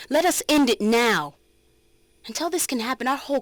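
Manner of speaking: a firm, loud call to end it; forceful plea. Acted. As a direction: demand an end, ringing out. Rallying plea closing speech.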